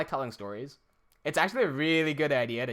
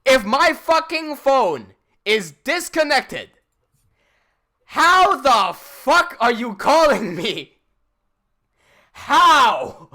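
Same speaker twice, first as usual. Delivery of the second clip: yelled, much louder and much higher, slow; furious shout; 11 semitones higher, 15 dB louder, much slower than usual. Real, not acted. Streamer yells at the scam caller, furious and loud. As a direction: blow up at the scammer's nonsense. Ranting at absurd scam call.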